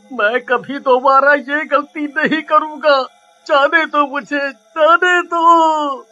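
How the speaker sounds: frightened begging, last plea drawn out; pleading desperately. Acted. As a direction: beg to be let go. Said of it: "गिड़गिड़ा ही रहा". (गिड़गिड़ाते हुए)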